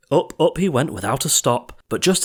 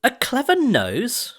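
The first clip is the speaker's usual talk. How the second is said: scornful hiss; 14 semitones higher, slower than usual. A narrator's reading. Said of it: "his mother hissed".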